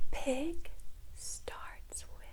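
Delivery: barely audible whisper; whispered prompt. Shown as a demonstration. Teacher leaving room for the student's answer, whispering. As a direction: whisper the answer under your breath.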